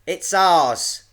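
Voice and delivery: high, loud retort; loud, high-pitched child's shout; shouting back. A narrator's reading. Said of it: "Iris shouted back".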